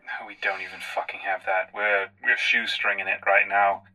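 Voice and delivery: ordinary talk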